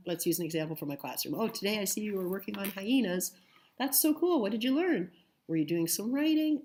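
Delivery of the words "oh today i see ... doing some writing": higher, enthusiastic voice of a parent; interested parent. Acted. Parent asks child about the day's learning, high-pitched and keen. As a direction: act out an encouraging parent, pitch lifted high.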